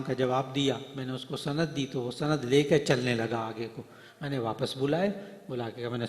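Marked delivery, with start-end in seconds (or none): none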